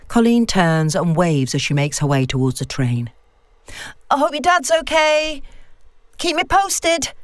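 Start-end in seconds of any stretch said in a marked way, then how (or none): 4.1-7.1 high, carrying call to a departing friend; voiced a touch higher, calling across the platform; calling after her. A narrator's reading. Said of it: "she calls after her".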